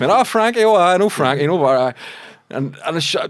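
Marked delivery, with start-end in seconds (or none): 0.1-1.9 high, loud, eager gush over a familiar name; light up at a known name; excited recognition. Retold or imitated. To the officer asking about Frankie, eager excitement.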